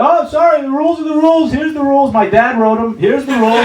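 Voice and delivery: high, loud mocking rule-follower voice. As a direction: mimic an officious hipster reciting rules.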